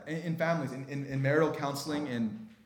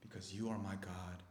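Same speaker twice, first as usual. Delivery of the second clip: very soft, low declaration of faith; hushed prayer; 4 semitones lower, 12 dB quieter than usual. Shown as a demonstration. Preacher shows how to speak to God, in hushed low worship. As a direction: pray almost under the breath, reverently.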